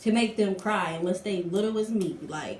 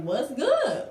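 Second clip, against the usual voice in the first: casual challenge; 5 semitones higher than usual. Retold or imitated. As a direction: toss out the challenge coolly. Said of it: "So I'm just like".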